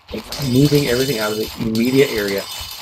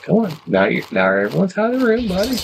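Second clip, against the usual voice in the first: higher-pitched retold coaxing, rising at the end; retold plea climbing high to a buddy; coaxing appeal; 11 semitones higher than usual. Officer to inmate with the others out, coaxing.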